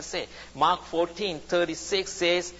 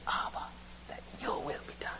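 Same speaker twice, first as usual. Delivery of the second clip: hushed prayer; 13 dB quieter, faster than usual. Acted. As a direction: whisper a surrendering prayer. Voicing a believer's cry to God.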